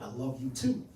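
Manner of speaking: usual speaking voice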